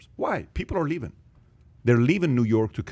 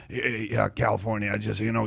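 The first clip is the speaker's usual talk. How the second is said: lightly imitated raspy voice, a little quieter; a hint of a raspy politician; raspy politician impression; 4 dB quieter than usual. Podcast guest mimicking a politician's speech, lightly raspy voice. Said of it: "he has got that raspy voice".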